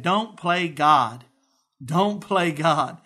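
Don't play God, slow, each word weighed; slow emphatic quote. Retold or imitated. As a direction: press hard on Don't, slowly. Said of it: "He said three words".

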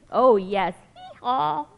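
lively storybook reading voice; reading to children. Acted. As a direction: read it like a picture book for kids. Reading picture book aloud.

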